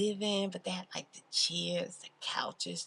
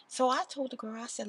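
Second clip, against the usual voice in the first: ordinary talk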